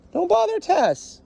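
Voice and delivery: very high, slow call to a dog; drawn-out warning. Real, not acted. Trainer warns a dog away from Tess, high drawn-out warning.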